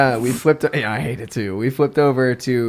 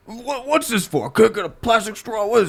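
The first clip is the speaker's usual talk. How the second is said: imitated complaining customer; 6 semitones higher than usual. Customer questions the bartender about the metal straw, imitated complaint. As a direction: lightly mimic a puzzled, fussy customer. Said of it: "the guy goes".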